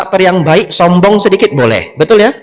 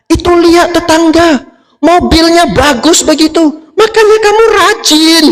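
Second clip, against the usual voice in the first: high-pitched, scolding nag; shrill-free mock scolding, pitched way up; nagging wife; 13 semitones higher than usual. Wife nagging about neighbour's car. (istri mengomel dengan suara tinggi)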